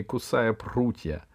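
plain reading voice

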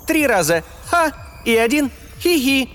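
high, mocking, cawing voice with giggles; cynical cackling AI. A narrator's reading. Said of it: "Нарочито цинично и размеренно прокаркал".

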